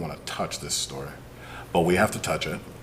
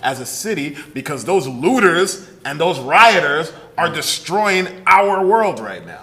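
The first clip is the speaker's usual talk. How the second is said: hammed-up concerned citizen; sarcastic; 6 semitones higher, 6 dB louder than usual. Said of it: "yeah, the sarcasm".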